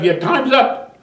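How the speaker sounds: lightly acted call to wake up; retold wake-up call.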